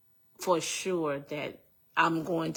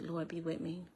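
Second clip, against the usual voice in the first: hushed prayer; 9 dB quieter than usual. Retold or imitated. Woman retells praying as the gun is aimed, hushed. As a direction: drop the voice into a private prayer. Retold prayer under threat.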